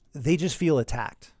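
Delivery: usual speaking voice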